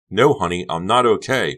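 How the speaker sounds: loud emphatic cry; cried. Acted. Becca steps toward Honey, loud and angry. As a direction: throw it at her loud. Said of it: "Becca cried".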